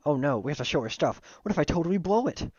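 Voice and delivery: rising, worried character voice; hammed-up sudden nerves; mock panic. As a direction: let the worry climb high by the end. Character panics about showing their stuff.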